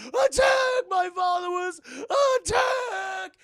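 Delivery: very high shouted command to attack; villain battle cry. Retold or imitated. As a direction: bellow the order like a cartoon villain. Villain orders his army.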